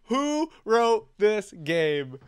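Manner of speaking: drawn-out yell. Real, not acted. Laughing outrage at game.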